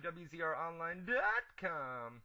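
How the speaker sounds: slow, spelled-out plug; plug read. Acted. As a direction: announce the web address. Show plug.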